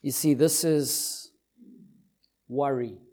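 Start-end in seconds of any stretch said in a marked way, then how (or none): none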